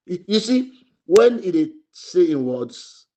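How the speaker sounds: normal talking voice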